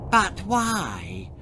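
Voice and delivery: put-on high-pitched lisping child; lisping little sister. Little sister questioning the bullies, lisped. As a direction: ask it as a puzzled small girl. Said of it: "lisped Milo's little sister Eliza".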